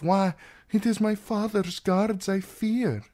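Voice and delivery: lightly voiced younger girl; girlishly young. A narrator's reading. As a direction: lift the voice into youthful fear. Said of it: "For a moment she sounded younger than Marcella".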